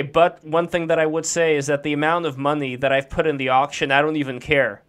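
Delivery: ordinary talk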